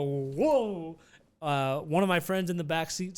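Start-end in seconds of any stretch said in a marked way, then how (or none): 0.0-1.0 re-enacting passengers thrown sideways; drawn-out, lightly acted whoa; mock startled whoa. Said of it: "he jerks the wheel. We all go".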